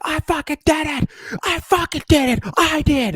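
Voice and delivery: hammed-up victory yell; imitated gloating yell. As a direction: scream it like a gloating hacker. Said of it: "by yelling into the screen".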